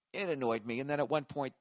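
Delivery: normal talking voice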